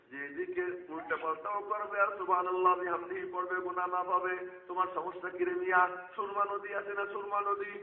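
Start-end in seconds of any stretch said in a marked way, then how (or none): 1.6-7.7 loud, high, slowed preacher's cry; preaching at full force. Acted. Preacher calling out to the gathering in a loud, high cry.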